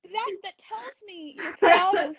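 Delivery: ordinary delivery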